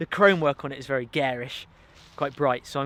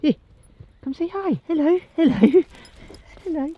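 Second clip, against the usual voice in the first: much higher sing-song call to the dog; high coaxing voice; 12 semitones higher, 5 dB louder than usual. Real, not acted. Calling the terrier over, high and coaxing.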